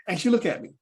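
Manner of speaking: ordinary delivery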